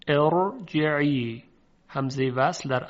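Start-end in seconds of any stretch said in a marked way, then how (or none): 0.1-1.4 ارجعی very slow, with a thick r; heavy r. Shown as a demonstration. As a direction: stretch out ارجعی, sounding the first vowel and a full heavy r.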